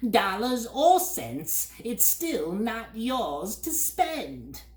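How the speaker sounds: high, slow, lofty bird voice; haughty bird. A narrator's reading. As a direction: talk down to him like a snooty bird. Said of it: "the bird replied haughtily".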